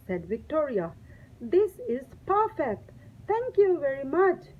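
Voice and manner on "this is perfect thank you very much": put-on girl thrilled with her cut-up sandwich; delighted. A narrator's reading. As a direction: gush with pleasure. Victoria delighted.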